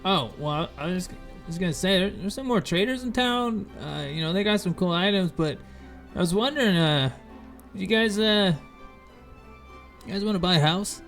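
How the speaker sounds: high, chipper trader voice; goofy merchant voice. Acted. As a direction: greet them like a shifty peddler. Fizban sales pitch.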